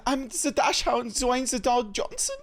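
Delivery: put-on the dog's silly German accent. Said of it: "my German accent".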